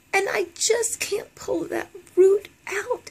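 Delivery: breaking into tears, high and slow; words catch in real sobs; crying. Real, not acted. Telling viewers about her father's words, crying. Confessing lifelong body shame.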